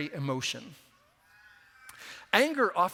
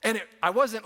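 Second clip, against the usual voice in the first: normal talking voice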